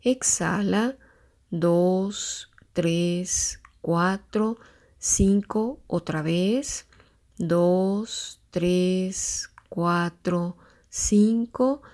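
slow, drawn-out counting of breaths. Shown as a demonstration. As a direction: count each breath slowly and calmly. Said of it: "te tomes cinco tiempos para inhalar".

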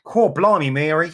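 cartoon Cockney exclamation; mock Cockney. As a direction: exclaim in a badly faked Cockney accent. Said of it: "really bad Cockney".

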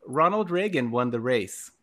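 put-on reciting a childhood poem in rhyme; poem recital. Reading his third-grade poem to clear the air, a mock recital. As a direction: read it like a proud poet. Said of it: "and it goes like this".

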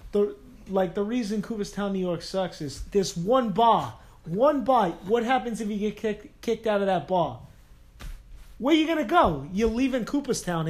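hammed-up New York accent, getting worked up about the one bar; New York accent rant. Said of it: "I just love this accent".

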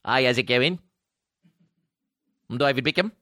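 light mock English gentleman greeting; lightly voiced Beckham; imitating Beckham. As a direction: play Beckham saying hi, lightly. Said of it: "he's like".

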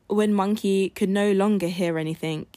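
plain reading voice

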